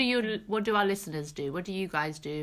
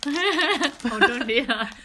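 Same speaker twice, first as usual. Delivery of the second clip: high, loud and laughing; real laughter through a protest; 8 semitones higher, 6 dB louder, much slower than usual. Real, not acted. Host begging co-host to stop, laughing.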